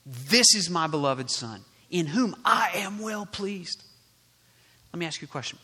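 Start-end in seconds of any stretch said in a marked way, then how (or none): 0.1-3.8 voice from heaven. Acted. God speaking at Jesus's baptism, proclaiming. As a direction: proclaim it strongly as God the Father. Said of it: "voice came".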